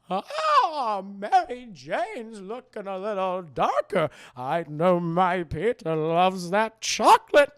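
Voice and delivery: loud, slow sultry aunt; sexy Aunt May voice. Retold or imitated. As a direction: purr it seductively like a flirty aunt. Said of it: "gonna sound like this".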